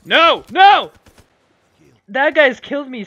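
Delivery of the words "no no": loud, high desperate cry; real alarmed shout; panicked no. Real, not acted. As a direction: yelp it as death comes. About to be killed.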